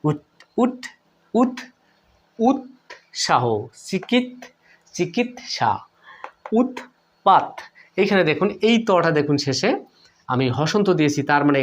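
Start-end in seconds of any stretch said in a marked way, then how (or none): 2.4-7.5 উৎসাহ, চিকিৎসা, উৎপাত split after the closed t; higher, word by word, pausing on the t in উৎসাহ and উৎপাত; broken syllables. Shown as a demonstration. Teacher sounding out examples, split at the closed t. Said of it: "তাহলে এই শব্দগুলো দেখুন".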